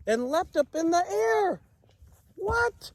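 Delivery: high excited rise into a squeal; excited leap. A narrator's reading. Reader thrilled as giraffe leaps, high squeal. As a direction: launch up high with delight.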